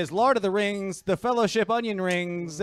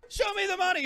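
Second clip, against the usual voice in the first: movie yell; 14 semitones higher, faster than usual. Acted. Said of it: "Jerry, you gotta yell".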